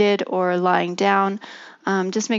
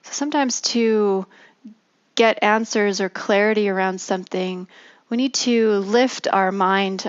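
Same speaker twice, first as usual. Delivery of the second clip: guided meditation. Acted. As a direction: ease them gently into stillness.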